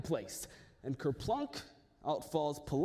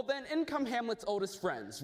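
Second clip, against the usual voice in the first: plain reading voice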